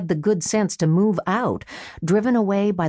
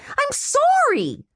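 a much higher, drawn-out squeal; squealed apology; slower than usual. Acted. Brother squealing an apology to his furious sister, high and slow. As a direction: squeal it out in panic. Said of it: "he squealed".

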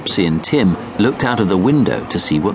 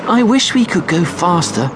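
put-on high eager child voice; a small boy wishing impatiently; 9 semitones higher than usual. A narrator's reading. Child voice in read story.

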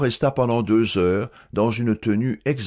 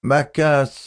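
usual speaking voice

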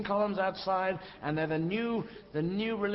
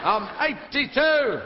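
slow, high, drawn-out bellow; old lady shouting; 8 semitones higher, 8 dB louder, much slower than usual. Acted. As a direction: shout each syllable out at full volume. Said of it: "where they start shouting".

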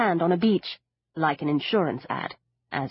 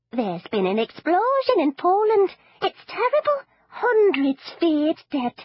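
put-on higher old granny voice, slow on the last words; granny savouring bad news; ghoulish granny; 10 semitones higher, 3 dB louder than usual. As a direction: relish the catastrophe with mock dismay. Granny relishing disaster news.

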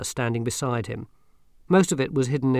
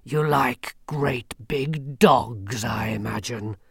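grim reaper voice; much slower than usual. Acted. Death speaks.

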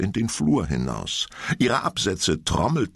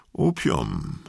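dreamy; 8 semitones higher than usual. Acted. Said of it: "antwortete eine junge Frau versonnen".

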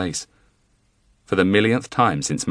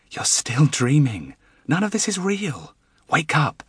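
slow, higher coaxing voice rising to a plea; lightly voiced urging wife; 9 semitones higher than usual. A narrator's reading. Wife urging dreaming husband to wake, rising plea. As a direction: gently pull him out of the dream.

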